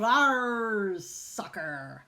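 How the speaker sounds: a lightly voiced pirate growl after the kick; pirate yell. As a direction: growl it like a captain kicking a ball. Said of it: "kicked the ball and yelled".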